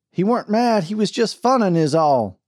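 put-on loud, high young voice; excited young cowboy. A narrator's reading. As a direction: defend a friend, loud and high. Bill voiced in story.

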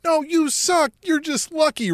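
high, mocking crowd voice; high sneering jeer. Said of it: "everybody's like".

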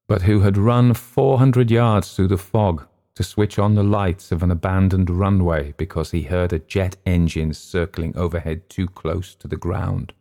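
ordinary delivery, nothing marked

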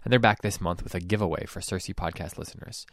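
low, soft, measured sponsor read; podcast ad read. Acted. Host to podcast listeners about a giveaway, ad read. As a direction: read the sponsor message evenly and quietly.

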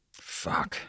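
hushed, voiceless curse; muttered to himself. A narrator's reading. Father spots who is at the window, muttered curse. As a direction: swear softly under the breath. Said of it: "Doug muttered to himself".

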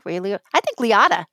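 ordinary talk

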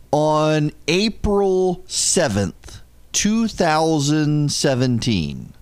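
slow, spaced-out reading of "April seventh, two thousand seventeen"; measured, emphatic slow reading of the date; slow deliberate date. Shown as a demonstration. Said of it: "repeats the release date deliberately".

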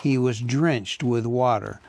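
ordinary delivery

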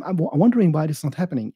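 usual speaking voice

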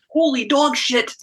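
high, slow, drawn-out disbelief; stunned exclamation. Real, not acted. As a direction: gasp it out, truly floored. Shocked at five days deadline.

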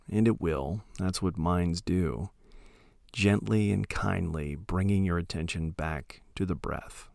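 soft, unhurried meditation voice with long pauses; calm, hushed instruction, letting each phrase trail into silence; guided meditation. Acted. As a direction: gently guide listeners into settling, phrase by phrase.

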